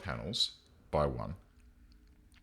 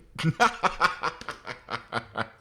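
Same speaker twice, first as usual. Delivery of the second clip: long, high peal of laughter, dying away; drawn-out gleeful laugh; delighted cackle; 9 semitones higher, 5 dB louder than usual. Real, not acted. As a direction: keep laughing helplessly until it runs out. Delighted at finding diner booths.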